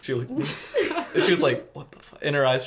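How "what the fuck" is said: barely audible stunned murmur; mock whisper. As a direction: breathe out the shock almost silently. Mouthing teacher's silent shocked look.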